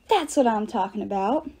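plain reading voice

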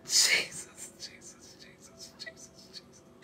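breathless, soft, fading repeated whisper; whispered prayer. Real, not acted. Preacher overcome, whispering Jesus to herself. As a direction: whisper his name, overcome.

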